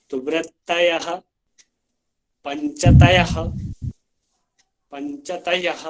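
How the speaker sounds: slow पंचतयः with a light half य; soft half sound. Shown as a demonstration. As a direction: draw out पंचतयः, the य barely sounded. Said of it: "sound जो आएगा वो कम आएगा".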